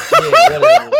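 laughing. Retold or imitated. Retold border agent waving off.

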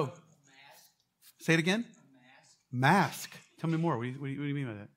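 ordinary delivery

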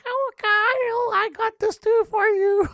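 very high, loud, slow put-on voice; hammed-up doting mother, drawn out and high; pretend mom. Host teases caller about his mom, mock doting falsetto.

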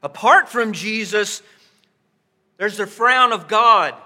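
loud, building preacher's warning; loud preaching that drops away at the end; preaching at full force. Real, not acted. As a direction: thunder God's law at the sinner.

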